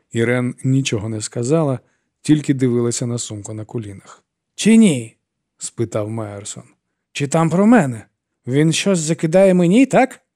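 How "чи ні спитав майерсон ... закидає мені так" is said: high, louder, rising demands; agitated demand. A narrator's reading. Myerson demands whether the notebook accuses him, agitated. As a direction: press her harder, nearly shouting.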